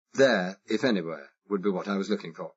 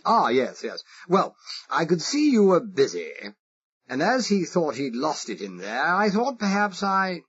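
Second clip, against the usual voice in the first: exaggerated posh English accent; 7 semitones higher, 4 dB louder than usual. Spy asking the Irish foreman, exaggerated posh English accent. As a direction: play the harmless toff with a marked English accent. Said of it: "I exaggerated it".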